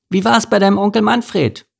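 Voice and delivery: high-pitched chirpy question; cheerful calling. A narrator's reading. Merle asking Mike about his uncle, cheerful and high. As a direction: ask it high, carrying to a distance.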